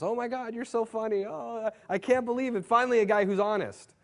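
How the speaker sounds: high falsetto girly gushing, hammed-up; mock gushing girls. Mocking the girls who messaged him, in a high falsetto. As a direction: squeal it like an excited girl.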